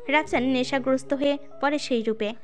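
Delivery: ordinary talk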